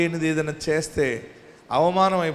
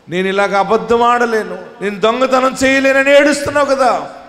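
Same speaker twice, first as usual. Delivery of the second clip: loud, high preaching at full force; fiery preaching; 3 semitones higher, 3 dB louder than usual. Acted. Preacher telling the weeping faithful God hears them, fiery. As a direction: rouse the hall.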